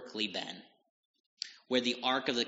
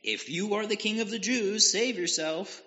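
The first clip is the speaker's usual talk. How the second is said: mocking soldier; 10 semitones higher than usual. A narrator's reading. Soldier taunting the dying king, high mocking jeer. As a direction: sneer it up at him from below. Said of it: "the soldiers also mocked him".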